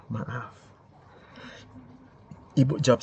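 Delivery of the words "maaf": very soft, lower murmured reply; gentle apology. Acted. Wife answering an angry husband, very soft and gentle. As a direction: apologise meekly, barely audible. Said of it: "Jawaban yang lemah lembut".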